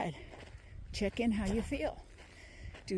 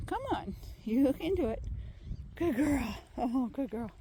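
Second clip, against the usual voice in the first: higher, coaxing praise for a pet; praising the dog; 3 semitones higher than usual. Real, not acted. Owner encouraging her sore-footed dog, coaxing praise. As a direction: cheer the tired little dog on.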